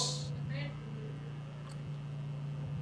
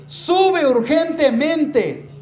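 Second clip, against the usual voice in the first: "Sube urgentemente" said slowly and higher, leaning on "Sube"; urgent command; 6 semitones higher, much slower than usual. Shown as a demonstration. Preacher voicing Jesus calling John up, "Sube" slow and urgent. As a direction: stretch out "Sube" like an urgent order from above. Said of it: "Sugiere o denota una acción urgente".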